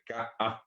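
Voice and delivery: slow ka-a with a clean stop between vowels; glottal break in ka-a. Shown as a demonstration. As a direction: say ka, cut the voice, then a. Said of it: "Fíjense que dije ka-a". (ka-a lento, con pausa entre las vocales)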